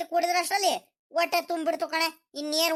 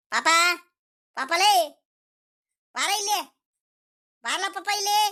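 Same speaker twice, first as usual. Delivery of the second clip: calling out; 3 semitones higher than usual. Acted. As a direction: call the boy to come here. Grandfather calls grandson from afar.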